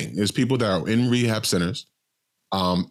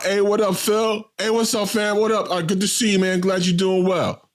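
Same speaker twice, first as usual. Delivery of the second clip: put-on high, bright hellos to old friends; friendly greeting; 11 semitones higher than usual. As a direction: greet them like old pals. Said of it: "He knew the cats in the crowd".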